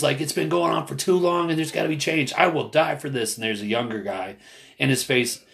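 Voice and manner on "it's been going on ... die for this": imitated yelling. Older protester venting at younger man, mock yelling. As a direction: retell his fed-up outburst in a raised pitch. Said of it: "he was just yelling".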